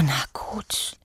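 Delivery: whispered, reluctant na gut; grudging whisper. Acted. Frau Asperilla gives in to Juri, whispered. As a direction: give in under your breath. Reluctantly agrees to keep reading.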